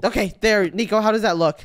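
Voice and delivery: normal talking voice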